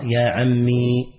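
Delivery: "يا عمي" slowly, with the long final ya sounded; full original form. Shown as a demonstration. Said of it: "وأصلها". ("يا عمي" ببطء مع إظهار الياء)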